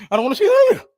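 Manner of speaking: hammed-up childish refusal; mock huffy whine; petty whine.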